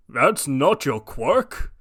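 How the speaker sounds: confused All Might. Acted. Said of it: "All Might, confused".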